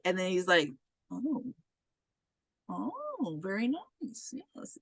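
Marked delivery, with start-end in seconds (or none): 1.1-4.8 very soft, higher-pitched murmur; hushed, dawning approval; quietly impressed. Retold or imitated. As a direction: imitate a teacher softly approving. Teacher reads research, quietly impressed.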